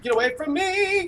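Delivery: high, sing-song panicked wail; panicked shriek. Pretend. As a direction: squeal it out in mock terror. Fleeing a Wendigo in panic.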